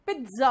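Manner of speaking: "पिज्जा" said PIT-za; correct pizza pronunciation. Shown as a demonstration. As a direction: put a t before the z in "पिज्जा". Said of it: "pronunciation थोड़ा अलग निकलता है".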